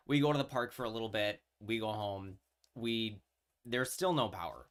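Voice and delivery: ordinary talk, nothing marked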